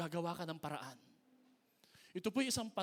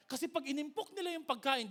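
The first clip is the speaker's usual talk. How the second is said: normal talking voice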